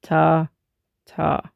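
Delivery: evenly spaced syllables on the beat; measured rhythmic counting; steady beat count. Shown as a demonstration. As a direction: tap out "ta" evenly, one per beat. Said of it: "that is the beat".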